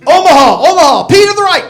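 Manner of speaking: loud, high barked play call; quarterback audible call. Pretend. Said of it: "like Peyton Manning calling an audible".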